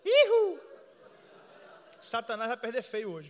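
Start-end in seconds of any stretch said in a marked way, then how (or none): 0.0-0.6 high, loud joyful whoop; triumphant whoop. Real, not acted. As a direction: let out a victory whoop. Triumphant cry after the story. (grito de vitória agudo)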